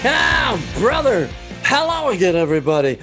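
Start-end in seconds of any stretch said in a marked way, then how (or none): none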